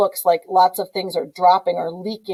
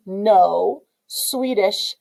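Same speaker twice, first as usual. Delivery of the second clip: very slow, word by word; drawn out slowly; much slower than usual. Shown as a demonstration. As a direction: stretch each word out. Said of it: "you get the country of Norway".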